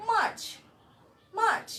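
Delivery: March said loud, in one unbroken beat. Shown as a demonstration. Teacher models March in a single beat. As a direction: say March at once, no break. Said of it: "telling this word at a glance".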